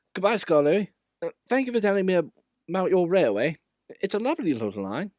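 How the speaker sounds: high, lively, fast goodbye; engine character voice. A narrator's reading. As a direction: voice a friendly engine.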